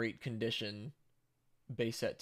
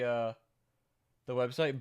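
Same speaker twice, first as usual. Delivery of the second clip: ordinary delivery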